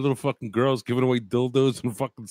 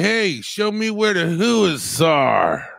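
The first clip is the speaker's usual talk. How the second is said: slow mock street accent; slow, lightly done street accent; 3 semitones higher, 4 dB louder, slower than usual. Imitating the caller's neighborhood, in a mock street accent.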